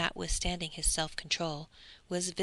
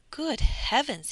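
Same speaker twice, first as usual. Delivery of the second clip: exclaimed; 7 semitones higher, 5 dB louder, slower than usual. A narrator's reading. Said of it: "he exclaimed".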